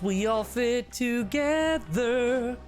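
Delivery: usual speaking voice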